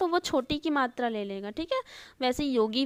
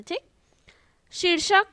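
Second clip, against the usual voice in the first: ordinary talk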